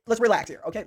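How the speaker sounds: normal talking voice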